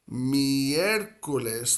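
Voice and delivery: miércoles slow, leaning hard on the ER; stress on first E. Shown as a demonstration. Spanish teacher showing where the accent falls, stressed first E. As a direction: stretch miércoles and land on mi-ER. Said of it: "And that first E".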